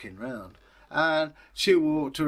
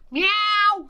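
very high, loud meow; cat meow; 7 dB louder than usual. Acted. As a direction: miaow like a cat. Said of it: "said the cat".

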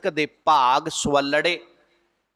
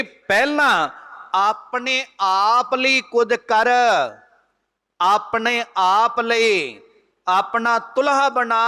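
slow preaching emphasis; 6 semitones higher, slower than usual. Acted. Preacher urging listeners to act for themselves, slow and raised. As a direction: drag it out to drive the point home.

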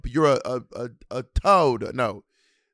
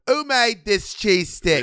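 slow, higher mock-British chef question; bad British accent; 6 semitones higher, 4 dB louder, slower than usual. Repeating the chef line for her cohost in a bad British accent, slow and higher. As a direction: ham up a Gordon Ramsay accent. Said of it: "Bitch, I don't know what accent you doing".

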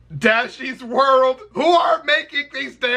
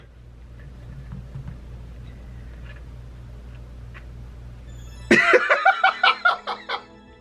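stifled giggles; 6 dB quieter than usual. Real, not acted. Laughing at friend.

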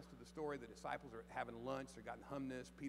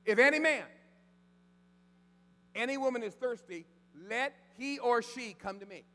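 loud proclamation; 8 semitones higher, 13 dB louder than usual. Acted. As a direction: cry it out like Jesus at the feast. Quoting Jesus at full force.

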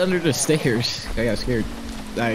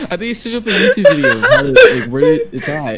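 laughing; 8 dB louder than usual. Real, not acted. Laughing, excited.

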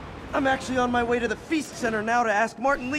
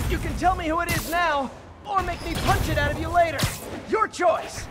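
threat; 7 semitones higher than usual. Acted. Hero threatens captured robber.

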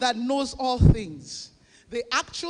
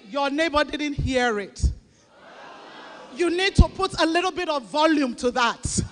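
loud, raised, coaxing preacher call; urging shout; 2 semitones higher, 4 dB louder than usual. Real, not acted. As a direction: rouse the congregation to speak up.

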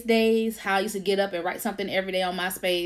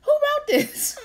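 mock surprise. Voicing her own surprised inner thought.

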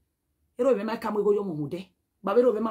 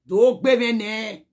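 "Do gbe me ne" stretched out slowly and louder; slow, pointed "Do gbe me ne"; slow emphatic repeat; 5 dB louder, much slower than usual. Shown as a demonstration. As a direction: drag out "Do gbe me ne" word by word. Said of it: "repeated slowly".

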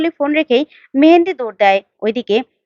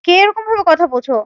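mildly astonished, higher-pitched questioning; taken aback; 5 semitones higher than usual. A narrator's reading. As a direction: demand to know who it is, in surprise. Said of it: "অবাক হয়ে যায়". (অবাক হয়ে প্রশ্ন)

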